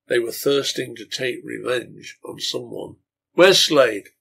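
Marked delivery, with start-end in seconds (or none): 3.3-4.1 louder, demanding shout from the crowd; a man in the mob shouting for Slade; shouted question. Acted. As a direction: call out across a bar room. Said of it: "shouted one from the middle".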